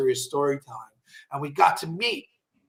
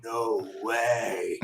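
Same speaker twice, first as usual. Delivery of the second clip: low, hushed no way in shock; stunned; 8 semitones lower, 7 dB quieter than usual. Retold or imitated. Hearing Hulk Hogan on the line, hushed shock. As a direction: gasp it out low, star-struck. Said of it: "I'm like".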